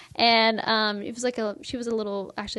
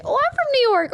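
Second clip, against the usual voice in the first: high, louder mock exclamation; delighted stranger; 5 dB louder than usual. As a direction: imitate a stranger's gleeful surprise, pitched way up. Retelling strangers' delighted reactions to name.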